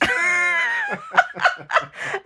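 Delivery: big shrieking belly laugh; real laughing fit. Real, not acted. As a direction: burst into helpless laughter. Laughing at the retold remark.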